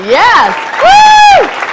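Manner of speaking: a loud yes rising to a very high whoop; loud shouted yes, then a shrieking woo; excited cheer. Real, not acted. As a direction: cheer her on like a fan. Cheering for a friend.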